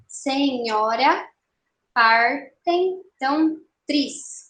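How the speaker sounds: "Senhora, partem tão tris" very slowly, syllable by syllable; escansão silábica. Shown as a demonstration. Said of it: "Bom, primeiro verso". ("Senhora, partem tão tris" bem devagar, sílaba por sílaba)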